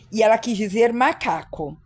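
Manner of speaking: ordinary talk, nothing marked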